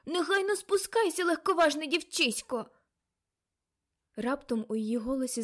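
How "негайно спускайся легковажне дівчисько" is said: high, loud command; urgent scolding. A narrator's reading. Aunt ordering reckless girl down from tower, scolding. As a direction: order the child down sharply.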